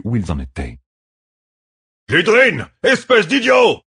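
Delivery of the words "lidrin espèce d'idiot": high, loud battlefield yell at a reckless subordinate; angry cry. A narrator's reading. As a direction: shout him down across the battle. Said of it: "s'écria Ituralde". (cri furieux, voix haute et forte)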